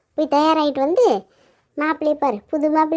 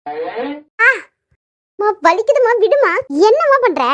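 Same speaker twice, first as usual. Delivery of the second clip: pained protest; 3 semitones higher, slower than usual. Acted. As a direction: complain to mother that it hurts. Protests that she is being hurt.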